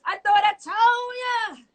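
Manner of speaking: high, drawn-out playful holler; catchphrase shout. Pretend. DJ repping No Limit to the host, playful high shout. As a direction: stretch it out like a hype man.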